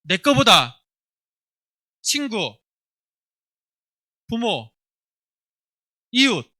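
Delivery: put-on loud, slow lines for the children to repeat; loud call-and-response for a children's service. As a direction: lead the kids through it phrase by phrase, with pauses. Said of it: "따라합니다".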